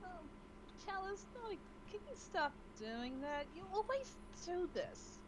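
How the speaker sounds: exasperated. Acted. Character scolds pet.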